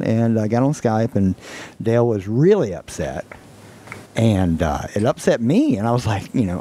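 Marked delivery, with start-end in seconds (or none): none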